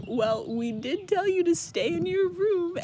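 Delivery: high, loud, sneering taunt; smug mocking Tamlin. Tamlin mocking Feyre about staying in her room, high smug sneer. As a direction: jeer at her like a smug bully.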